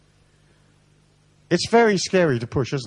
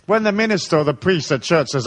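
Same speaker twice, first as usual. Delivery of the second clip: usual speaking voice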